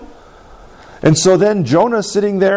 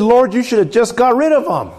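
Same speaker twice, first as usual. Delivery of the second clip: higher-pitched, mildly exasperated complaint; a little frustrated; 7 semitones higher than usual. Retold or imitated. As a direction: voice a prophet grumbling up to God. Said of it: "Jonah gets a little frustrated".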